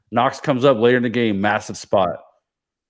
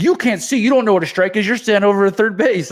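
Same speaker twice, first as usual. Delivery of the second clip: very high, loud mock umpire rant; retold yelling; 11 semitones higher, 5 dB louder than usual. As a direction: imitate an umpire yelling back at a coach. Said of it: "the umpire's starting to yell".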